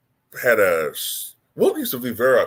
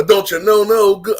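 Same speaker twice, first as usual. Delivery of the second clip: high, loud comic catchphrase; Martin Lawrence character; 8 semitones higher, 8 dB louder than usual. Shown as a demonstration. Impressionist doing Karl Malone as a Martin Lawrence character, high and loud. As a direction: belt it out like a sitcom goof. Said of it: "Martin Lawrence character".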